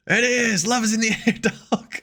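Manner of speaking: high voice breaking into a laugh; mock lovey-dovey. Truth agreeing love is in the air, mock lovey-dovey. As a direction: play it giddy and high-pitched. Answers in the acted romance.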